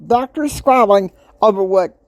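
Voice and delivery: exasperated outburst. Real, not acted. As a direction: vent the frustration. Venting about squabbling doctors.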